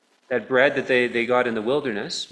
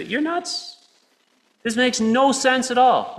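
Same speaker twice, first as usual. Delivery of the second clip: high, scoffing dismissal; mocking scoff; 10 semitones higher, slower than usual. Listener scoffing at the preacher, high mock disbelief. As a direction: toss it off as a disbelieving skeptic. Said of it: "you might be looking at me here and say".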